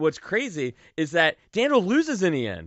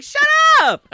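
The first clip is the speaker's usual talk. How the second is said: mock shout; 13 dB louder than usual. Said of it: "I'm like".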